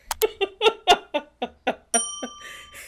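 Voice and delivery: high, helpless run of laughter; real extended giggling fit; long laugh. Real, not acted. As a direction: keep cracking up, high and loud. Keeps laughing at the character.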